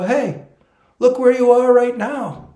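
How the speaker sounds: high, loud triumphant spirit; humorous spirit call. Retold or imitated. Spirits showing him his dream came true, high loud. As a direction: proclaim it like a joyful spirit. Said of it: "the way they speak is humorous".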